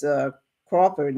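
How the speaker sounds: usual speaking voice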